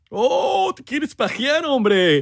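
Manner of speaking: very high, hammed-up teasing; goofy scandalized skit voice; mock shock. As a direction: squeal it to tease a friend.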